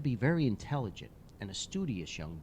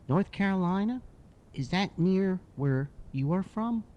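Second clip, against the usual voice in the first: put-on curious little boy; 8 semitones higher, 5 dB louder than usual. Boy quizzing the hockey player about places, high and curious. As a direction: ask like a curious kid.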